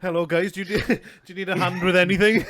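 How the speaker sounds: high, loud and breaking into laughter; laughing mock offer. Friend joking an offer to help, laughing mock offer. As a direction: call it out brightly through giggles.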